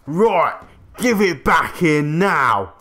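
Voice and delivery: loud, high Cockney yell; sudden Cockney shout, imitating a furious kid; Cockney accent. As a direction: yell it in broad Cockney, last word drawn out. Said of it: "did a Cockney accent".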